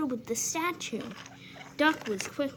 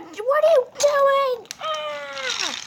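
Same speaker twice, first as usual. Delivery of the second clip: high, loud terrified cry; panicked scream; 10 dB louder, slower than usual. Acted. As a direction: shriek as you fall. Engine pushed off viaduct.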